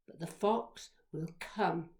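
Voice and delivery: usual speaking voice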